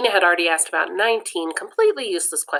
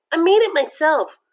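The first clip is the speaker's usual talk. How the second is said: proud knitter; 9 semitones higher, 4 dB louder than usual. Acted. Knitter answers the insult.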